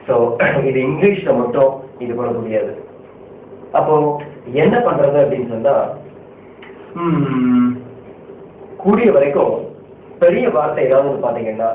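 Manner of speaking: ordinary talk, nothing marked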